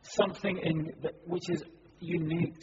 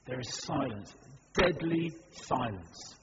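ordinary talk

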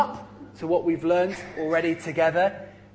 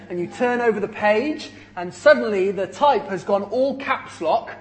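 ordinary delivery

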